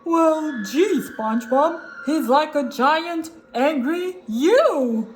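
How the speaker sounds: loud, deliberate cartoon voice, last word drawn out; Patrick. Patrick explains the resemblance to his friend, halting. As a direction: spell it out haltingly in character.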